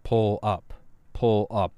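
flat robotic cockpit alarm. Retold or imitated. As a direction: say it like an automated warning. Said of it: "the robot voice just going".

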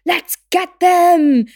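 loud, high, drawn-out rallying shout; mock battle cry. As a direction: yell like attackers charging in. Mimics ride workers ganging up.

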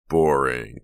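loud, drawn-out taunt; sing-songed. A narrator's reading. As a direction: stretch it into a teasing sing-song. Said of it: "Chuck sing-songed".

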